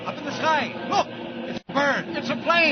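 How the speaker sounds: loud, high announcer cry; excited opening cry. Acted. Announcer opening the show, excited shout. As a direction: call it out like spotting something overhead.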